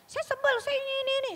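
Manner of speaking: very high-pitched mock sulky whine; sulking. Wife sulking after a quarrel with her husband, petulant. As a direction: act out a huffy wife's whiny complaint. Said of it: "kalau ngambek lagi ribut sama suami".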